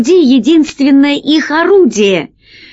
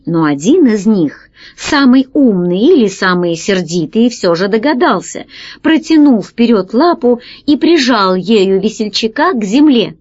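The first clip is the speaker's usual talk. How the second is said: ordinary delivery, nothing marked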